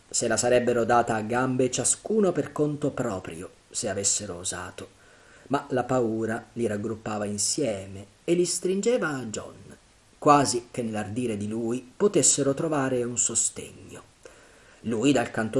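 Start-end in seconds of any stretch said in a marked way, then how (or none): none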